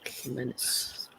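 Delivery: whispered. Real, not acted. Says mom's maiden name softly.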